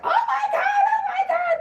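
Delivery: shrieked far up, laughing through it; playing the shocked bystander, squealing; mock shocked scream. Mocking bystanders who should have screamed, high laughing shriek. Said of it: "they wasn't even like".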